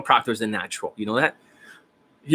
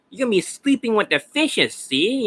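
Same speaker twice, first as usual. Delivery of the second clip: put-on high, gangster movie mobster accent with a tough Italian-American twang; 9 semitones higher than usual. To the coaching client about looking Italian, a mock mobster Italian accent. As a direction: play a wiseguy threatening someone, mock Italian mobster accent. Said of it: "Italian are brown hair guys".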